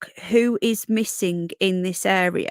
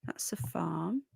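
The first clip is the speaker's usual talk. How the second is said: whisper; 4 semitones lower, 13 dB quieter, slower than usual. Real, not acted. Whispered aside while browsing map.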